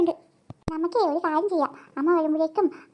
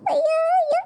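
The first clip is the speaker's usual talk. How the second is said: high giggle; 9 semitones higher than usual. Acted. Child laughs over biryani.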